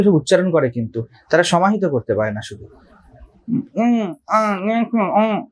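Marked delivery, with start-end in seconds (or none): 3.5-5.5 imitating mute humming. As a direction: hum the vowels like someone who cannot speak. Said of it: "এভাবে বলছে না কথা".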